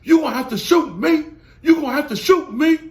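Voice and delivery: loud, high imitation of him daring them; mock loud threat. Vlogger imitates the man daring them to shoot, loud and high. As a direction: shout it as a hammed-up dare. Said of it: "then he starts talking about".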